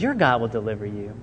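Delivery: a low, soft promise to Daniel; quietly steady him as he goes in; hushed reassurance. Retold or imitated. King Darius comforting Daniel at the den, low and soft.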